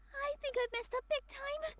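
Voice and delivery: a panicked admission to her friends; dismayed realization. Acted. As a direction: let the worry crack through. Realizes Inaya may have left.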